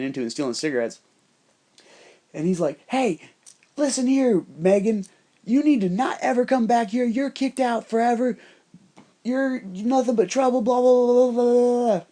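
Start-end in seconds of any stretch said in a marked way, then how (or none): 2.9-12.0 high, mocking scolding voice; hammed-up tirade of banishment; imitated angry yelling. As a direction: yell it like a fake preacher, trailing into loud babble. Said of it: "He's yelling at her".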